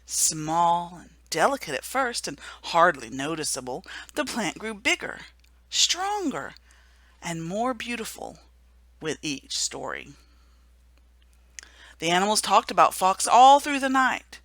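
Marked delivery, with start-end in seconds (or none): none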